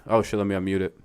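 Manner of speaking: ordinary delivery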